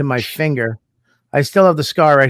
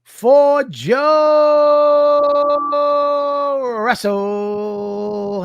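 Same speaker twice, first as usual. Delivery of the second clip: announcer intro; 10 semitones higher, much slower than usual. Acted. Host introducing Joe Russell to listeners, booming announcer call. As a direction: drag out the name like a ring announcer.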